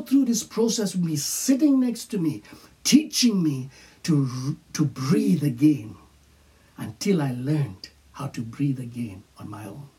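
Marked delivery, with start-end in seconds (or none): none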